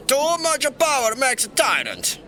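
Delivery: high, put-on voice reciting a rule; mock quotation. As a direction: parody a pompous lawgiver. Quotes the law mockingly.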